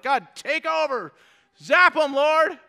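high, loud mock plea for vengeance; impatient demand. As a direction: impatiently urge God to act, hammed-up. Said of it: "we want it now".

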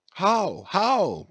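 high, eager repeated question; eager cry. A narrator's reading. Young Spaniards crowd the teller, crying out high and eager. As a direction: press him for the story, eager. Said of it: "cried all the young Spaniards crowding".

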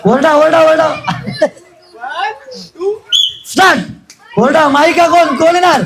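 high, excited game-host calls; urgent cheerleading from the host; rousing the crowd. Real, not acted. Host whipping up crowd at start.

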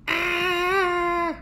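high shocked yelp of falling; high cry. Acted. Scaredy Monster crashing his bike, high yelp. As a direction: cry out as you tumble.

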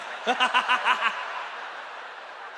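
hammed-up rapid ha-ha-ha; fake happy laugh. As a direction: laugh too eagerly, on purpose. Mimicking looking too happy to intruder.

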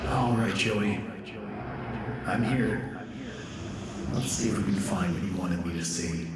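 soft, echoing murmur to oneself; subdued voice in an echoing space; hushed musing. Acted. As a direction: speak quietly to an absent friend. Arriving, talking to absent friend.

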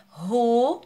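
ru set apart and held; single syllable demonstrated. Shown as a demonstration. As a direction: sound out ru as its own syllable. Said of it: "já é outra sílaba".

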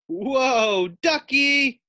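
high, loud startled cry; alarmed whoa. A narrator's reading. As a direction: hang on and yelp.

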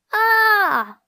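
high, loud cry of dismay; sudden high wailing yell; Peter's yelp. A narrator's reading. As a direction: yelp out "ah" in alarm. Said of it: "yelped Peter".

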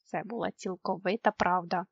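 plain reading voice

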